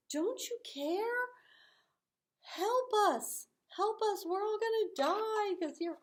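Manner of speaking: high, loud desperate cries; acted terror, high and loud; panicked pleading. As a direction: beg for help as the boat rocks.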